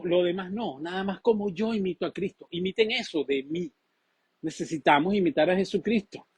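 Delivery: ordinary talk, nothing marked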